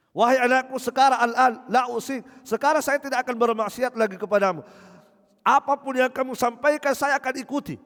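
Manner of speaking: loud, high, frantic plea; a father crying out loud to his son for mercy; desperate begging father. Acted. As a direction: beg your son as if it is too late.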